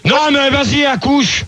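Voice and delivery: high, exasperated order; real irritated hurry-up; impatient prodding. Real, not acted. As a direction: push the caller to get on with it. Host hurries rambling caller.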